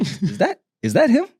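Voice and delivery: mock spotting someone, pitch climbing on the repeat; tentative, then excited recognition; acted double-take. Acts out spotting the wanted man.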